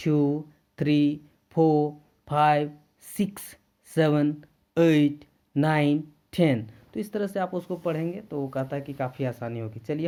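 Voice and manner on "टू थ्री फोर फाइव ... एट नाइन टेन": slow, loud drill counting; counting to ten. Shown as a demonstration. Teacher counts to ten again with the class. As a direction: stretch out each number for the class. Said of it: "फिर से हम लोग ten तक पढ़ते हैं".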